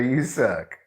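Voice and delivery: ordinary delivery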